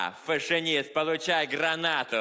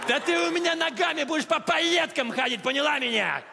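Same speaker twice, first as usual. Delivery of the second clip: raised threatening yell; angry shout; 9 semitones higher than usual. Acted. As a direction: bark the threat at her. Guard threatens woman in cubicle.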